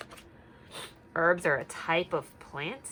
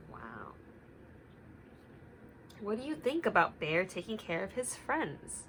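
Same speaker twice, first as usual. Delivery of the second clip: ordinary delivery, nothing marked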